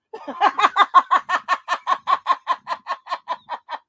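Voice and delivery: long, high laughter tailing off; real laughing fit. Real, not acted. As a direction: crack up until you run out of air. Cracks up at own reaction.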